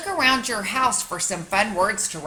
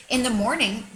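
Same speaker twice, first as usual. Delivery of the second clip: ordinary delivery, nothing marked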